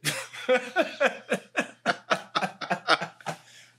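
long high cackling laugh; real laughter at his own memory; high burst of laughter. Real, not acted.

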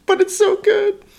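very high squeaky voice. Acted. Continuing squeaky bit.

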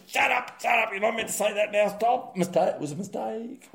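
mock panicked hush. Host mocking panicking officials, frantic high hush. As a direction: squeal it like officials scrambling to hush a blunder. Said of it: "And everyone went".

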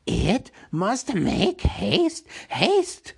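high, slow creature voice; Gollum urging haste. Acted. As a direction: urge it on with a rising Gollum screech. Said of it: "said Gollum".

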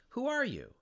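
startled high demand; high questioning voice. A narrator's reading. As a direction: ask it high and sharp, falling at the end. Dante questions the shades.

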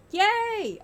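high, drawn-out yay; high celebratory yay; mock cheer. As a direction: cheer for the segment.

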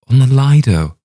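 high, loud, emphatic answer; agitated insistence. A narrator's reading. Young man answering the doubting rabbi, agitated.

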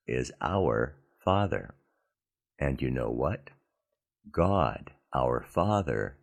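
normal talking voice

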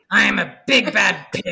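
loud, gruff threat; tough biker guinea pig. Acted. Guinea pig warns the imaginary Fluffy off his food, gruff and loud. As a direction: bark it like a grizzled biker. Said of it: "biker with a pack-a-day habit".

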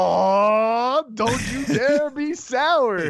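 high, slow, hammed-up Scottish accent; bad Scottish accent. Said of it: "in the worst Scottish accent".

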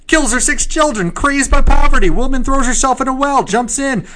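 high, loud newsboy hawking headlines; old-timey paperboy crying the news; newspaper kid. Pretend. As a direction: shout the headline like a street newsie. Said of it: "read these like a newspaper kid".

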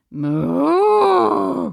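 loud crying moo; cow moo. Shown as a demonstration. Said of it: "something's happening to that cow".

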